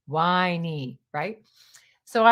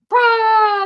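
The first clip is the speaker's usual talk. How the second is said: much higher, loud "bah"; shouted surprise cry; 13 semitones higher, 10 dB louder than usual. Retold or imitated. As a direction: burst out like a crowd yelling surprise. Surprise party reveal.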